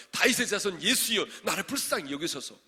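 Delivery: loud, high desperate plea; crying out. Acted. Blind man calling to Jesus for mercy, crying out. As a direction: call it out at full voice. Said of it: "얼마나 여러분 소리를 진짜 불러".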